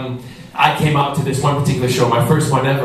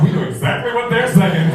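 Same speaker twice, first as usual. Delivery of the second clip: smug deaf friends; 9 semitones higher than usual. Retold or imitated. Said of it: "and they're like".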